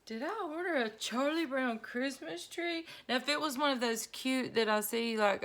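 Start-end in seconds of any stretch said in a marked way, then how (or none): 0.1-2.9 mock disbelief. Husband sees the tree.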